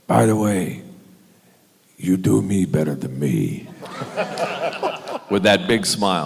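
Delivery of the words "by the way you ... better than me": amused Pacino voice, paying a compliment; Al Pacino impression. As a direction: tease him fondly in Pacino's voice. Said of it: "he just looked at me and he went".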